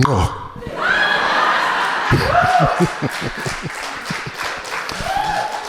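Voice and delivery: comic cracking up at his punchline, high; high cackling laugh. Real, not acted. Big laugh.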